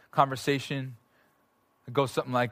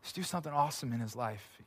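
crying prayer; 5 semitones higher, 4 dB quieter than usual. Retold or imitated. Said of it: "We're both crying".

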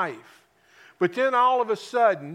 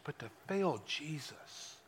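hushed weight; 5 semitones lower, 14 dB quieter, slower than usual. Acted. Preacher drops voice for weight.

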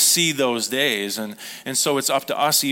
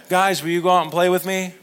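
put-on higher, louder little-boy plea; child pleading to play; 6 semitones higher, 5 dB louder than usual. Grandson calls to older boys, higher and louder. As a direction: beg the big kids to come play.

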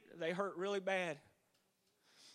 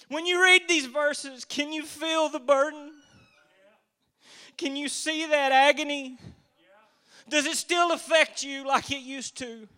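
raised, fervent sermon voice; preaching; 2 semitones higher, 6 dB louder than usual. Acted. Preacher pressing the congregation about the garden, fervent preaching. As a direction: preach the agony with urgency.

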